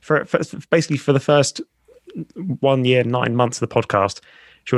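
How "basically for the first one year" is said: ordinary delivery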